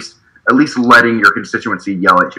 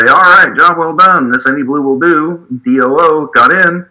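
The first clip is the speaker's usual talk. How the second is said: mock complacency; 6 semitones higher than usual. Mocking complacent Democrats.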